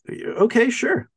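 high-pitched, put-on okay; lightly played, high agreeable quote; mock easy agreement. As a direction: imitate a breezy yes, pitched well up. Acted quote of agreeing to offer.

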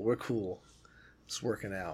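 very soft, low muttering; muted agreement. Acted. Battered fighter going along with the maniac in his corner, hushed agreement. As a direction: trail down to a near-whisper, playing along.

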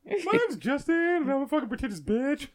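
high whiny mocking impression; mocking Justin voice. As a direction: pitch up and mock him. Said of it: "[name], do it in that voice".

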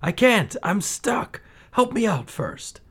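put-on high, pleading boy's cry for help; a desperate boy begging from below; trapped boy calling out. A narrator's reading. Aladdin stuck underground, pleading for help.

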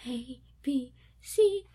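put-on childlike chanted alphabet; singsong recital. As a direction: recite it like a little kid. Kids reciting alphabet in bathroom.

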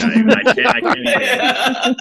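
can't get it out for laughing; real giggling fit. Real, not acted. Cracking up mid-apology.

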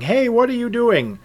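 high-pitched retold protest; lightly acted objection; mock grumbling. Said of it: "the owner of the sword started grumbling".

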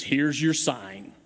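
slow, drawn-out punchline delivery; slow comedian catchphrase, low and deadpan. Retold or imitated. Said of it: "that line was".

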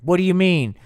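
loud, high what do you mean; mock demanding shout. [name] demands answer from [name].

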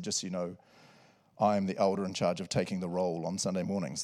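lightly voiced self-important elder; low proud elder. The pastor imitates the elder who takes the roll, in a low, proud voice. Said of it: "He said".

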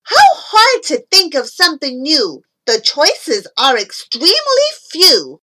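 put-on loud, high exasperated boy; shouting. A narrator's reading. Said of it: "he stood there shouting".

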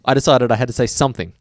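normal talking voice

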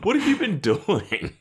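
hammed-up incredulous squeak, slowly; mock disbelief.

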